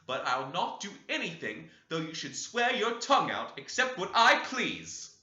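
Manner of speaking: high, loud, climbing defiance; defiant outburst. Acted. The young lady defying Heathcliff, high and loud.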